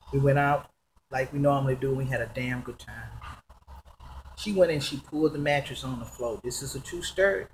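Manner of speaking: ordinary delivery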